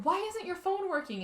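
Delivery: mildly frantic acted demand; retold freaking out. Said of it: "like, freaking out".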